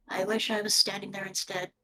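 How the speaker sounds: the official giving away a flicker of envy, low and soft, lightly voiced; quiet, low inner wish with a touch of envy; mild envy. Game master voices an official's fleeting envious look, mild envy. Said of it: "a flicker of a look of".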